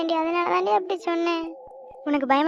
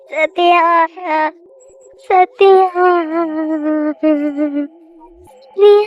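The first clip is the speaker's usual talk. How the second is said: louder with each call of the name; an unseen voice calling, building up; 8 dB louder, much slower than usual. Acted. Voice calling Sathya repeatedly.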